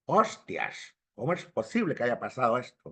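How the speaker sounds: low, quick imitation of a Spaniard; Spanish accent from Spain. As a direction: mimic Castilian with shushed s sounds. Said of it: "ese es un español".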